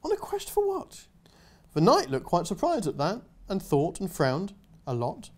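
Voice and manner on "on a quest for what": put-on much higher, wide-eyed child's question; awed little girl. Girl asking the knight, awed and high. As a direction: ask in wonder like a little girl. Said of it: "the little girl said in awe".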